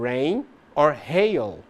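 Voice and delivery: rain with an English R and hail with a breathy H, said slowly; careful English demo. Shown as a demonstration. Teacher to Brazilian students, slow demo of R versus H. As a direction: slowly model rain or hail so the R and H sound different. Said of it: "algumas começam com R ou H".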